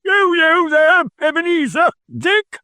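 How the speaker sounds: a fat, jovial boss hollering for his apprentices. A narrator's reading. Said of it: "comfortable, oily, rich, fat, jovial voice".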